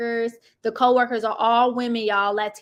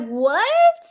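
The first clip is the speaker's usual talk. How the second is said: high, loud shocked voice; wife hearing the news, mock shocked; 6 semitones higher, 4 dB louder than usual. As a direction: play the stunned wife. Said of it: "And Chantel's like".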